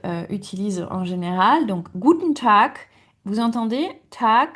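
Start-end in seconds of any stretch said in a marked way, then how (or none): 4.1-4.5 "Tag" ending in a K; final K sound. Shown as a demonstration. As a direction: finish "Tag" on a crisp K. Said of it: "à la fin on va prononcer K".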